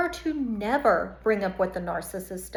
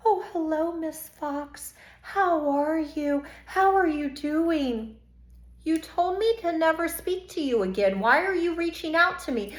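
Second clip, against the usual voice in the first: mock sweet message; 5 semitones higher, 4 dB quieter than usual. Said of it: "send me messages".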